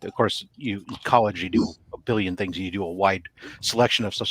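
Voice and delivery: ordinary talk, nothing marked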